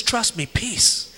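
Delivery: soft, slow soothing words; gentle slow voice of Jesus; calm reassurance. Retold or imitated. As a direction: settle a frightened friend. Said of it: "And Jesus says".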